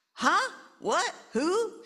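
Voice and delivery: high, loud confused yelps; half-asleep father startled by his daughter. Acted. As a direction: jolt awake, bewildered.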